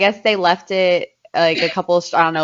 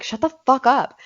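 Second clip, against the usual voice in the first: higher, sharp snap at the growling dog; half-asleep annoyance, pitched up; irritated at the dog; 7 semitones higher than usual. Retold or imitated. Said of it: "I'm like".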